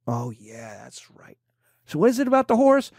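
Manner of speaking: put-on a hush-hush aside between old folks, voiceless; hushed whisper. Old folks whispering together about the horse, hushed. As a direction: whisper it as if nobody else should hear.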